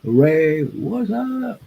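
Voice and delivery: squeaky high sing-song hello; playful cartoonish high-voiced hey; falsetto greeting. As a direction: pitch way up into a silly falsetto to greet a viewer. Greeting chat viewers in falsetto.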